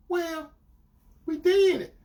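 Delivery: high, reluctant mimicked admission; confessing girlfriend. Girlfriend answering what happened, imitated reluctantly. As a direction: own up hesitantly in a high voice.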